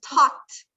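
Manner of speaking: "talked" as one syllable ending in T, tokt. Shown as a demonstration. Teacher gives class an example, "talked" said tokt with final T. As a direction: end "talked" on a crisp voiceless T. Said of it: "Se escuchan una T".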